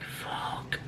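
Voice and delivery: long, voiceless whispered curse; whispered shock. Retold or imitated. Retelling a disturbing scene to viewers, shocked whisper. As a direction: whisper it as a stunned retold reaction.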